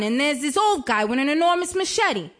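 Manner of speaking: usual speaking voice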